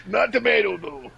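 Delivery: high, moaning put-on accent; vegetative patient. Patient refusing tomato soup, hammed-up feeble moan. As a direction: groan feebly, then whine the words. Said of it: "You're just gonna be in a vegetative state".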